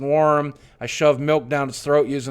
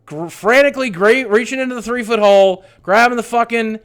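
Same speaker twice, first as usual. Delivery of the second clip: frantic; 8 semitones higher, 6 dB louder than usual. Real, not acted. Panicked retelling, digging up cat.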